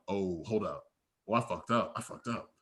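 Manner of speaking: low, hushed inner panic; quiet, sinking realisation; retold dawning alarm. Retold alarm as man reaches pocket.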